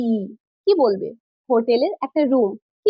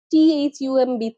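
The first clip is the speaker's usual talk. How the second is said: normal talking voice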